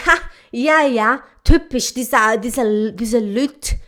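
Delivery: slow, drawn-out Swiss German sneer; mocking Swiss German. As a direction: mimic a dismissive Swiss local, dragging the words. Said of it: "ela ficou assim".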